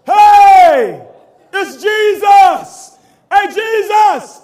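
loud, drawn-out shout across the water; calling out. Acted. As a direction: hail Jesus from the boat, loud and slow. Disciples calling out from the boat.

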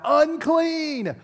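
high cried-out warning; calling out a warning. Retold or imitated. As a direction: call it out like the outcasts did. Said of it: "they had to yell out".

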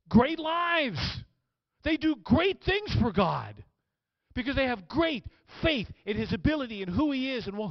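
high, loud, emphatic sermon delivery; fired-up preaching. Acted. Preacher on the rewards of great faith, impassioned preaching. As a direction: drive each point home to the crowd. Preacher at rising full force.

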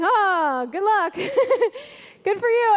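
high, laughing mock well-wishing; teasing laugh. Coworker laughing at the pastor, a high teasing laugh. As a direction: tease through a laugh. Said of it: "laughing at me".

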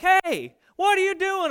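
high, loud indignant challenge; mad dad. Retold or imitated. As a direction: catch the kid in the act. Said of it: "I'm mad".

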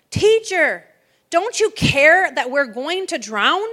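loud, high panicked cry; disciples shouting. Retold or imitated. Frightened disciples shouting at Jesus in the storm, loud and high. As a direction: shout it like men about to drown. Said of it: "They were shouting".

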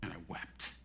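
very low near-whisper; solemn, whispered aside; hushed confession. Real, not acted. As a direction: let it sink almost to nothing. Moved recollection of being disciplined.

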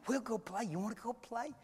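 very soft, playful invitation; hushed coaxing. Retold or imitated. Sheila tempting the child out to play, hushed coaxing. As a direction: coax a small child under your breath. Coaxing a toddler quietly in church.

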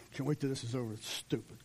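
a soft, sulky churchgoer aside; quiet grumbling complaint; sulky muttering. Retold or imitated. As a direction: mumble it like a bored teen in the pew. Voicing bored sulky churchgoer.

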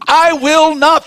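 "I will not" pitched high and punched; high, forceful stress on "I will not"; emphatic. Shown as a demonstration. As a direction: drive home every word of "I will not". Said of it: "Look at the emphasis God is telling us".

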